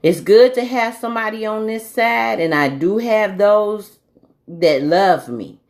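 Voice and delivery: usual speaking voice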